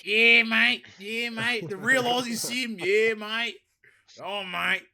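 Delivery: loud, high mock Aussie "Yeah, mate"; hammed-up Australian. Gamer mocking an Australian game, hammed-up Australian accent.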